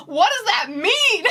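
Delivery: incredulous and slow; baffled. Real, not acted. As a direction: lean into the disbelief, drawn out. Baffled reaction to the plot.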